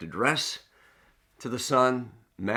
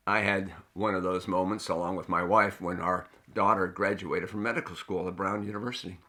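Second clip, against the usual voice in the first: normal talking voice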